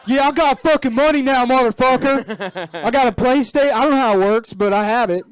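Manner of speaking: loud, high mock Baltimore guy bragging; Baltimore accent. Imitating a Baltimore guy bragging about his PlayStation in a Baltimore accent. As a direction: Baltimore accent, hammed up and shouted. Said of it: "That's the Baltimore accent".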